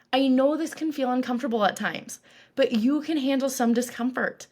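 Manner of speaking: usual speaking voice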